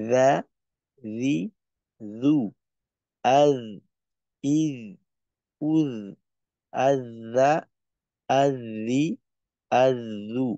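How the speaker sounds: রা রি রু then আজ ইজ উজ, each syllable spoken clearly and separately; Arabic letter drill. Shown as a demonstration. Teacher drilling a Quran letter for students, crisp syllables. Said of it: "যেমন".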